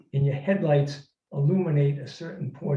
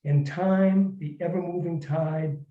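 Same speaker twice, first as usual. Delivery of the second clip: emotional story reading; 3 semitones higher than usual. Acted. Author reading his letter to Jennifer O'Neill aloud, earnest and swelling. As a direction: read the letter with rising heartache.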